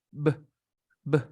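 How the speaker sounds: "B" as a short voiced "buh"; B sound. Shown as a demonstration. Teacher showing a student the B, isolated short sound. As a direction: pop out each "B" alone, short and voiced. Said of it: "El sonido de la B".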